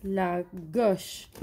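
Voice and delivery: drawn out slowly for pupils; slow teacher's explanation; slow explaining. Shown as a demonstration. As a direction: say it slowly so the kids follow. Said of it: "وين تروح؟".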